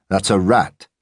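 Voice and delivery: lightly voiced mocking gym brute; weightlifter's sneer. A narrator's reading. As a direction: sneer it down at the little dog like a bench thug. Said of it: "the weightlifter said".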